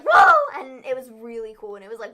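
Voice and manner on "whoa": high, loud amazed "whoa". Retold or imitated. Host recreating a crowd's shocked reaction. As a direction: cry out in amazement.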